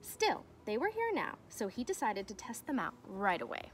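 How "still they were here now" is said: plain reading voice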